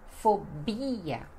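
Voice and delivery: "fobia" as fo-BI-a, stress on BI; Portuguese pronunciation. Shown as a demonstration. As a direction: draw out BI in "fobia". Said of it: "que no português fica".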